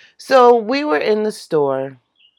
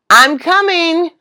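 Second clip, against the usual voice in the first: called out; 10 semitones higher, 5 dB louder than usual. Retold or imitated. Child calling out to parent.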